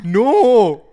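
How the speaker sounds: loud, high "no"; shouted denial. Real, not acted. Co-host rejecting the Brazilian language claim, shouted denial. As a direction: burst out in disagreement. Flatly contradicts co-host in dispute.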